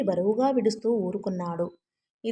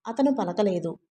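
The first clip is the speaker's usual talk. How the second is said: plain reading voice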